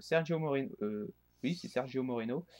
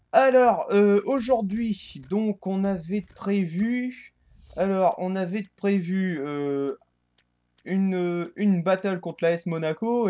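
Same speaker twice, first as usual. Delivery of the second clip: energetic intro; 6 semitones higher, 6 dB louder than usual. Real, not acted. Upbeat restart of intro.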